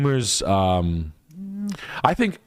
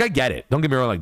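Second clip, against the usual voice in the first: ordinary delivery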